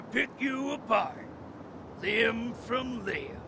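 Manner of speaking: slow menace. Acted. Villain threatens Conway, slow soft menace. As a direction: draw it out like a promise of pain.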